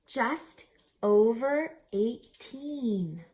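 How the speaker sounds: lively rhyming read-aloud with a bouncy lilt; storybook narration. A narrator's reading. Reader telling children a rhyming story, lively. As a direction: read it like a picture book to kids.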